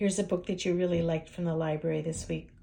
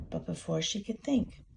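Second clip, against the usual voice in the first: usual speaking voice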